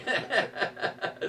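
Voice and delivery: high breathy laugh at his own story; real burst of laughter. Real, not acted.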